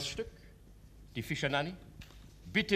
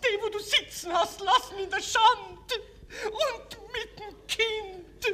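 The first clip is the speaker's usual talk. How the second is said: sobbing accusation; 6 dB louder than usual. Acted. Wronged mother confronts count.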